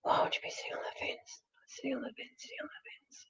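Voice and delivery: unvoiced whisper, fading away; whispered puzzling. Real, not acted. Puzzling over the grandmother's message, whispering to herself. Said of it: "What does that mean, Nana?".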